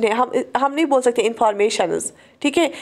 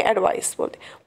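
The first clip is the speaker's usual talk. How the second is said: whispered aside; 5 semitones lower, 6 dB quieter than usual. Real, not acted. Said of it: "unvoiced aside".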